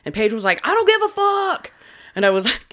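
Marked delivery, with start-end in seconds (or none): 0.7-1.7 very high-pitched mock yell; mad outburst, imitated. Daughter defying the coach, imitated mad outburst. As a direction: imitate a furious teen going shrilly high. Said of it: "Oh, she was mad".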